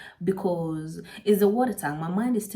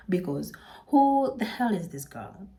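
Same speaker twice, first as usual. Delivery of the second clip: ordinary delivery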